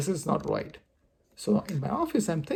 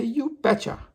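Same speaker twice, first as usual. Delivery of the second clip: higher, moderately raised voice; demonstrated loud call; 6 semitones higher than usual. Shown as a demonstration. Asking viewers to say it back, loud call. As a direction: pitch it up for viewers to echo. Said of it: "with a loud noise".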